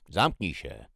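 loud, sudden snarl; lightly acted angry bark; snarled order. A narrator's reading. Someone in the corridor shuts another up, snarling. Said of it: "warknął ktoś w korytarzu".

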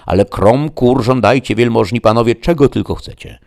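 high, emphatic innkeeper voice; exclaiming innkeeper. Acted. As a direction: swear it loudly, as if to convince a guest. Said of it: "zawołał oberżysta".

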